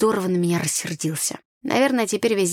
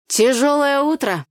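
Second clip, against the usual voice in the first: higher, loud teasing call; 7 semitones higher, 5 dB louder than usual. A narrator's reading. Neighbour in hammock to wet girl, calling out. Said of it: "выкрикнул мне Филатов".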